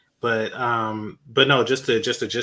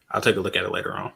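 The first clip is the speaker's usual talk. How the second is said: ordinary talk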